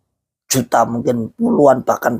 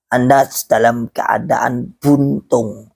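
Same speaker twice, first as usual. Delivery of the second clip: slow, drawn-out delivery; slow verdict; slower than usual. Real, not acted. Preacher telling followers where they stand, slow and deliberate. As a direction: spell it out slowly for the listeners. Verdict stated, then echoed softly.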